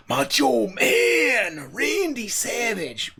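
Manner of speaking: slow, raspy wrestling-promo voice; Macho Man impression. Reactor channels Macho Man Randy Savage mid-sentence, slowly. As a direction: ham it up like a wrestler.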